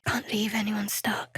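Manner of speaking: hushed stealthy whisper; soft sneaking whisper. Acted. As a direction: whisper so no one hears you sneak by. Sneaking past unseen.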